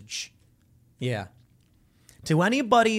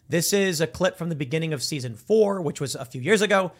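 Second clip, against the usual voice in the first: ordinary delivery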